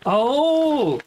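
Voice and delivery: sudden high loud oh; surprised gasp. Real, not acted. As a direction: caught off guard by the gift. Opening a fan's parcel.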